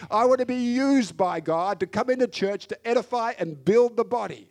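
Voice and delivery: loud, high, insistent prayer that will not let go; Jacob's pleading prayer. Acted. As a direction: cry out to God as Jacob, refusing to let go. Jacob wrestling God in prayer.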